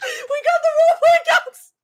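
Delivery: very high squealed announcement; giddy high shriek; thrilled squeal. Real, not acted. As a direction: squeal it out in disbelief. Thrilled accounts joined the chat.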